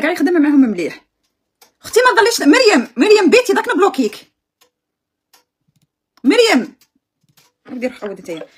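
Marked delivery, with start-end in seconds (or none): 1.8-4.3 raised, high-pitched scolding call; calling out a warning. Real, not acted. Calls out a warning to commenter. 6.2-6.8 loud warning call of a name; calling out sharply. Real, not acted. Calls Mariam by name, warning.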